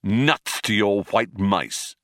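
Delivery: slow, drawn-out brush-off; dismissive. Acted. Zaphod brushes off Trillian's worry, slowly dismissive. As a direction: stretch it out, not caring.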